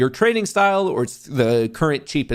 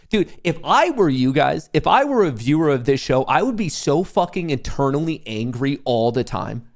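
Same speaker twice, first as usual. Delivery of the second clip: ordinary delivery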